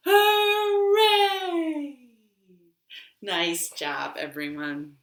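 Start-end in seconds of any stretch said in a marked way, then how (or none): 0.1-2.0 a big high hooray; excited cheer. Acted. Librarian cheering the toddlers after the song, excited. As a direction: cheer them on at the top of your voice.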